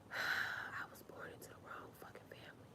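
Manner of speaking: breathy sighing whisper, fading out; exasperated whisper. Retold or imitated. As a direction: sigh it under your breath. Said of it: "And I'm like".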